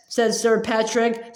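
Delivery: ordinary delivery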